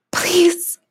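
high pleading voice; high-pitched entreaty; pleading murmur. Acted. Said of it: "I murmured".

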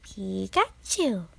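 slow, loud cartoon creature cry; Pikachu. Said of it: "I can do a good Pikachu".

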